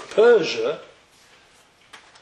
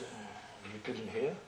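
much softer and lower, a hushed mournful question; hushed grieving reply; 7 semitones lower, 17 dB quieter, slower than usual. Retold or imitated. Yaakov quietly breaking the news to his brother. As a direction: break the news gently under your breath.

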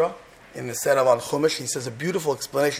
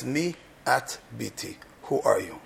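low, soft and slow; tender question; 5 semitones lower, 6 dB quieter than usual. Pretend. Boaz gently asking Rus who she is, soft and slow. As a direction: ask gently, with real care. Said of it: "the most beautiful three words".

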